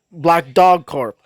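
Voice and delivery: plain reading voice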